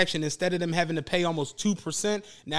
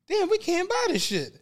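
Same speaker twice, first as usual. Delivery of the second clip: much higher, defeated outburst; exasperated disbelief; 12 semitones higher than usual. Retold or imitated. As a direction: give up in disbelief. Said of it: "I'm like".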